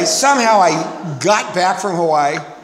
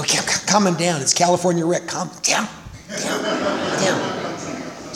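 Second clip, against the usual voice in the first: soothing hush; 5 dB quieter than usual. Retold or imitated. Junkies soothing hyper newcomer.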